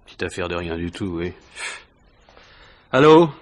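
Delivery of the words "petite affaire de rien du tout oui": low, soft sarcastic grumble to himself; bitter muttering. Acted. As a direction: mutter the boss's words back with disgust. Alone after the boss leaves. (marmonnement amer et sarcastique, plus bas)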